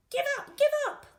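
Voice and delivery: high, loud gleeful robber shout; robbers crying out. A narrator's reading. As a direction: crow it over the beaten cop. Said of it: "the robbers cried".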